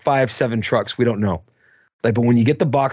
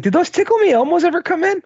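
boss asking; 12 semitones higher than usual. Retold or imitated. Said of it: "and he's like".

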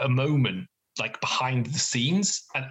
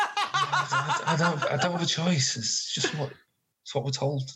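carried hostage; 4 semitones higher than usual. Acted. Captured staff member excusing himself to player, lightly voiced hostage. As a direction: plead lightly as a put-upon underling. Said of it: "The one who you're carrying says".

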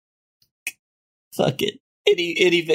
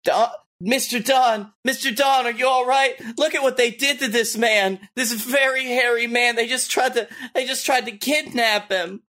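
concerned call; 7 semitones higher than usual. Acted. Player fussing over hurt Bigfoot, high concern. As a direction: fuss over the hairy man.

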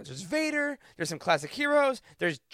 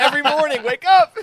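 loud, high wake-up call; mock alarm call; 5 semitones higher, 8 dB louder than usual. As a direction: shout it like an alarm.

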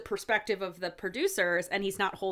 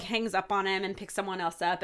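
usual speaking voice

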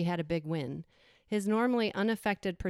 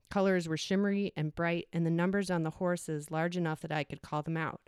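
usual speaking voice

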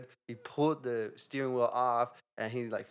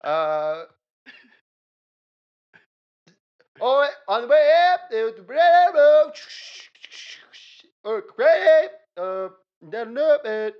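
high loud foreign celebrity voice; 10 semitones higher, 16 dB louder than usual. Acted. Famous actor voice in skit.

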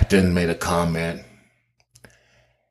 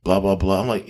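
ordinary talk, nothing marked

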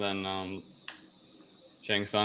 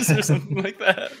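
laughing; 12 semitones higher, 7 dB louder, faster than usual. Real, not acted. Laughing after retold taunt.

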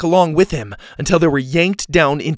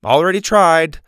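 calling out; 4 semitones higher, 6 dB louder than usual. A narrator's reading. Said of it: "Mac called after her".

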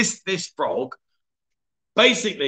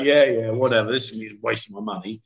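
dismissive; 7 semitones lower than usual. Retold or imitated. Acted sceptical reply to the old woman.

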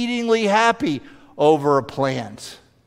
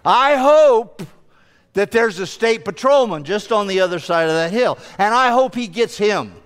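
gloating hope; 5 semitones higher than usual. Retold or imitated. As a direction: savour the thought of him getting caught. Driver hoping Porsche gets caught.

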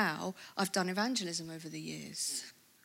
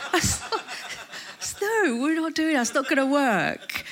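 high, loud laughing no; incredulous laughing protest; laughing refusal; 6 semitones higher, 4 dB louder than usual. Retold or imitated. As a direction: laugh it off in disbelief. Laughing refusal of shouting cure.

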